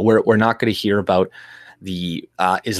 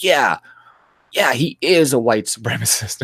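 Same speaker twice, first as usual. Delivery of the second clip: higher, mocking leftist voice, breaking into a laugh; mock leftist insistence; 4 semitones higher than usual. Voicing a leftist's reply.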